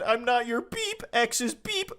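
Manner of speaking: high, slow, beeping through the curses; mock-read it with held bleep tones; imitated censor beeps. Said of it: "He's literally beeping himself with a sensor".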